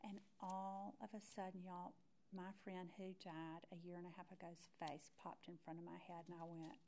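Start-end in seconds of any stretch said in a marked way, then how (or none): none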